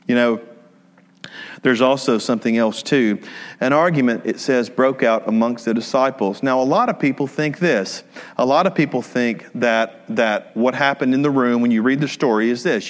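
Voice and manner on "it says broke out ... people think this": ordinary delivery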